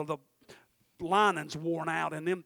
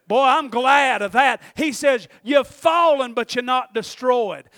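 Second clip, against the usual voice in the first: raised, louder sermon delivery; forceful preaching; 6 semitones higher, 10 dB louder than usual. Acted. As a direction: drive the verse home to the congregation.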